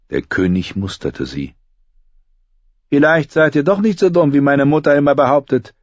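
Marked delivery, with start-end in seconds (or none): 2.9-5.7 young king, mocking and cold; cruel boy king. A narrator's reading. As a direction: sneer down at her.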